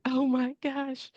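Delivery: high, slow, choked-up oh my gosh; reliving tearing up at a movie, voice rising; a mock-weepy gasp of overwhelm; teary gasp. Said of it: "And I was like".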